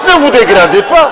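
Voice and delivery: high, loud exhortation; preaching. Real, not acted. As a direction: urge them with force. (exhortation vibrante)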